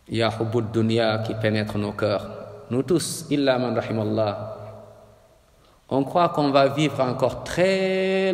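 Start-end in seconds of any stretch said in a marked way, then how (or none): none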